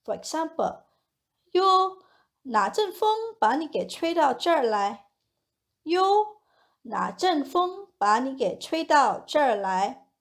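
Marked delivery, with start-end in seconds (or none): 1.5-2.0 short high, raised interjection of surprise; a higher, lightly surprised call; mild surprise. Shown as a demonstration. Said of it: "use yo to express surprise". 5.8-6.4 short high, raised interjection of surprise; a higher, lightly surprised call; mild surprise. Shown as a demonstration. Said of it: "use yo to express surprise".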